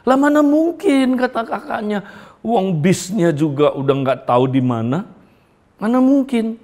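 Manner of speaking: a big sister scoffing at her brother, voiced high; no way, that's impossible.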